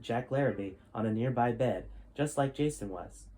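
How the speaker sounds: steady storytelling read-aloud voice; story narration. A narrator's reading. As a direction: tell the chapter like a bedtime story. Reads chapter twelve aloud.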